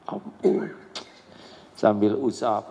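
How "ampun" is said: breath-only plea for forgiveness; whispered plea. Acted. Parent ending the prayer for forgiveness, in a whisper. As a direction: let the last plea fall to a whisper.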